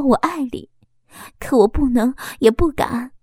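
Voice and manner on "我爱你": usual speaking voice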